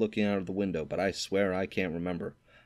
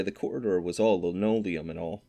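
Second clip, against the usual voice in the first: plain reading voice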